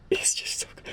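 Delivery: soft unvoiced whisper of awe; breathless whisper. Real, not acted. Fan whispering to viewers, overwhelmed by the episode.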